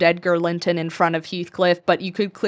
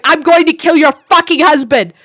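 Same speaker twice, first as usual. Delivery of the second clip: higher, louder mock threat; mock shout; 5 semitones higher, 7 dB louder than usual. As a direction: ham up a villain's yell. Heathcliff's threat voiced.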